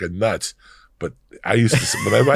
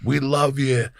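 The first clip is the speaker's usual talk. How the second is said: excited fan; 7 semitones higher than usual. Retold or imitated. Fans hugging him.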